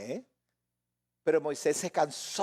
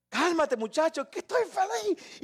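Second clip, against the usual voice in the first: put-on happy yelling child; 10 semitones higher than usual. Happy children running about, mock excited yelling. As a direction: act out an overexcited kid who won't calm down. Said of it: "Gritan y corren".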